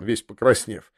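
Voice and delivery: ordinary delivery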